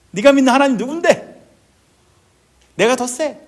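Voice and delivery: high, loud scornful challenge; mock their God with a cocky boast; dismissive taunt. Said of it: "무시하는 거죠". (무시하듯 높고 크게 도발)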